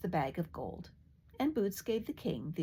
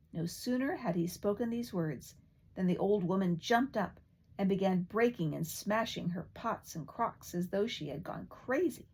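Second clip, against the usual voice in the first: ordinary delivery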